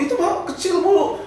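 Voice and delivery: high-pitched, offhand doctor imitation; breezy doctor. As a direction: brush it off as no big deal. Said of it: "dokternya ngomongnya gampang banget". (menirukan dokter yang menggampangkan)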